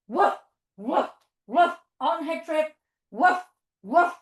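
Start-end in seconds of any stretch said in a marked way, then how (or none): 0.1-1.7 short playful woofs; dog bark. Pretend. Teacher being Lola on her trip, short barks. 3.1-4.1 short playful woofs; dog bark. Pretend. Teacher being Lola on her trip, short barks.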